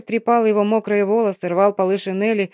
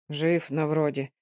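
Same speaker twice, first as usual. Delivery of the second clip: low, gloomy mumble; 5 semitones lower, 6 dB quieter, slower than usual. Acted. Frozen Mitka answers gloomily, low and soft. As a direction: answer darkly, barely bothered. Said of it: "мрачно раздалось в ответ".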